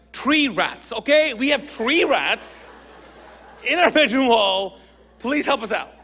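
loud, high, drawn-out key words; exasperated clarification. Retold or imitated. Man begging a confused woman for help with rats, exasperated. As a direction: spell it out in mock desperation. Gives up, rewords for confused listener.

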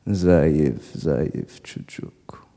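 low, slow, fading almost to a whisper; imitated father. Father on the telephone calling his son weak, low fading murmur. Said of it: "the sting from those few words".

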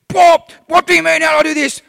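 high, loud snapped retort; mock angry outburst. Pastor imitates himself snapping at a friend, loud and high. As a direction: blow up at a harmless question, hammed up. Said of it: "absolutely go off my trolley".

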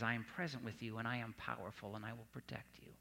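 a quiet promise that dies away; God speaking softly. Retold or imitated. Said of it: "and he says".

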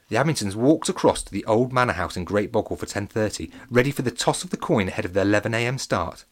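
plain reading voice

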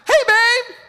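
wife calling out brightly at dinner time; bubbly wife. Retold or imitated. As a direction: breeze in cheerfully calling him. Wife arriving cheerful.

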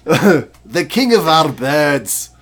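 loud, slow mock Scottish-sounding brogue; mocking imitation of accent. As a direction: exaggerate a friend's botched Irish accent, slowly. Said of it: "That's what you were sounding like".

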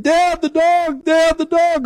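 put-on high, slow, calling out like a scared child; high child's shout for her dad; panicked little girl. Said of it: "she's like".

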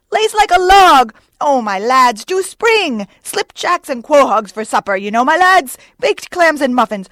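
high, loud, frantic excitement; Flask. A narrator's reading. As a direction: plead and cheer the rowers on wildly. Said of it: "cries Flask, dancing up and down".

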